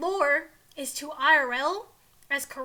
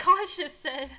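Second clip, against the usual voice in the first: ordinary delivery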